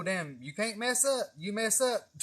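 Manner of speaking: usual speaking voice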